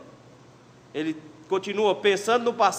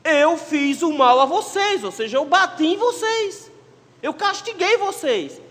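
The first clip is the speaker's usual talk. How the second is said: higher and louder, slow and emphatic; preaching fervor; 8 semitones higher, 4 dB louder, slower than usual. Acted. Preacher voicing God to the people, high, slow, insistent. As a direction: drive each phrase home.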